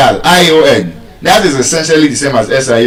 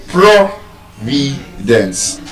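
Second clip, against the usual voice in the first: "Providence" in separate syllables, PRO strongest; first-syllable stress; 2 semitones lower, 4 dB quieter, much slower than usual. Shown as a demonstration. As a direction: break "Providence" into PRO, vi, dence, very slowly. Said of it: "it's just on the first syllable".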